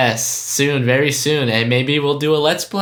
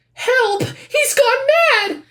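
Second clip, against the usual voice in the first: high squeaky cry for help; voiced comic panel; 3 dB louder, slower than usual. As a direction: shriek it like a panicked cartoon victim. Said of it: "do you want me to do the voices".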